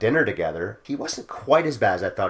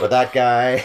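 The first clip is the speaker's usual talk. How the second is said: bad Irish accent; 4 semitones higher, 7 dB louder, much slower than usual. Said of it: "I can't do an Irish accent".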